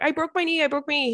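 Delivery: high, insistent whine; high whining insistence; mock whiny panic. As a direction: insist you broke it, half-hysterical. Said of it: "I'm like".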